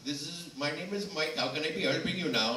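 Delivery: imitating an Indian call center agent; Indian call center accent. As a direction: play the Indian tech-support rep. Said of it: "you're the India guy".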